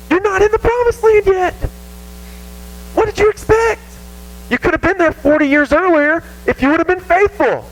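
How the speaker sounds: hammed-up scolding reply; mock stern answer. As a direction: snap back at the complainers. Mock reply to whining Israelites.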